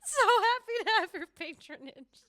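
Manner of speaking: high, loud sing-out greeting; hammed-up host welcoming a patron; overdone, drawn-out high welcome; mock show welcome. Mock greeting to the annoying viewer.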